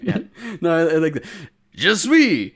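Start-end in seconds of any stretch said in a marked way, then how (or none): 1.8-2.5 big, loud, high fake French accent; hammed-up superhero with bad French; All Might. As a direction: bellow it slowly in mock French. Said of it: "All Might trying to do a French accent".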